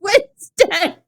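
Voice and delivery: real laughing fit mid-sentence; helpless laughter. Real, not acted. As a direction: struggle to get the words out through laughter. Cracking up while reading a comment.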